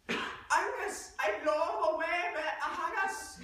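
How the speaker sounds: high, booming Scottish holler; hammed-up Scottish yell, not subtle; loud Scottish accent. Shown as a demonstration. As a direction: shout it in Scottish down a mountainside. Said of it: "has to be heard all the way down the mountain".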